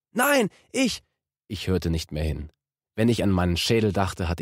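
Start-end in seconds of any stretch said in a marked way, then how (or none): 0.1-1.0 very high, strained protest; protesting driver. A narrator's reading. Cornered driver protesting to the detective, panicked. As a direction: blurt a panicked denial.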